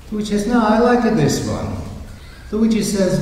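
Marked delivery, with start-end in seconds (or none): 0.5-1.8 put-on higher, insistent Italian accent. Luigi insists on the cow he picked, Italian accent. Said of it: "an Italian couple in southern Italy".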